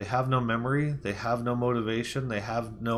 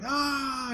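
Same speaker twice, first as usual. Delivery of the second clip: held-back, high retold scream; mock scream. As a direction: imitate screaming on one long high "ah". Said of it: "He starts screaming".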